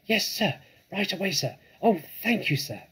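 high, eager youngster voice; high-pitched, grateful little helper; small badger. A narrator's reading. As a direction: pipe up keen and obedient. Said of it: "said the small badger".

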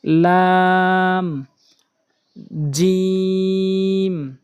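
slow, drawn-out letter names for learners; careful tajweed letter drill; Quran letter recitation. Shown as a demonstration. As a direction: pronounce each Arabic letter carefully. Teacher recites Arabic letter names.

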